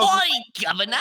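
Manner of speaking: high, loud Cockney greeting; a cartoon London street voice; mock Cockney. Game master playing a British townsperson, mock Cockney. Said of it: "all the little people you talk to are now British".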